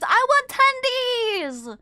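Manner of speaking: high, loud, slow fake cry for tendies; whiny tantrum. Streamer whining to chat for chicken tendies. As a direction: wail like a spoiled child. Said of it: "whining for tendies".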